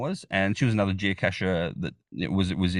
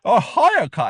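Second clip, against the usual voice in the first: put-on high, loud parody of an Australian accent; mock Australian accent; 11 semitones higher, 7 dB louder, slower than usual. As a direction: mimic a friend attempting an Australian accent. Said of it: "he tries to mimic my accent as well".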